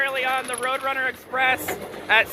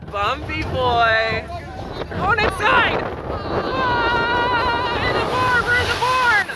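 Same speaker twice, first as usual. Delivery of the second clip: on a roller coaster; 10 semitones higher, slower than usual. Real, not acted. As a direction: yell through the bumps. Riding through the coaster.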